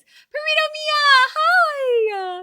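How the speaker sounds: high, loud, stretched greeting; excited squeal. Real, not acted. Streamer greets a raider with a high, loud squeal. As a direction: squeal hello to a new arrival.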